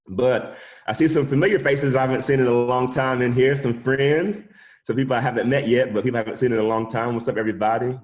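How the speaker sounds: loud, high, upbeat welcome; excited greeting. Real, not acted. Greeting familiar faces in class.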